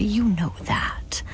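slow, gentle whisper. Acted. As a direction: soften it to calm her. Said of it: "Bishop said gently".

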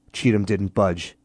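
plain reading voice

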